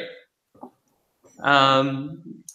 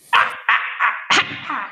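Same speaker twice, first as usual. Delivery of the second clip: high, airy witch cackle; crone cackle; 10 semitones higher than usual. Acted. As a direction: let out a mad hag cackle. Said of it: "Cackling laughter bursts like mad lightning".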